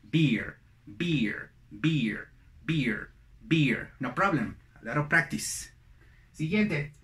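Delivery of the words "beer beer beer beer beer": "Beer" with ee, falling each time. Shown as a demonstration. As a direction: repeat "Beer" evenly on a clear ee. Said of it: "Con una I". ("Beer" con I, repetido)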